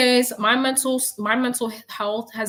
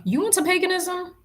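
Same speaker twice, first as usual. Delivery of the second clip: amazed questions; 6 semitones higher than usual. Real, not acted.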